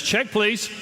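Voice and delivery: quick exit. Pretend. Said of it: "if she says yes".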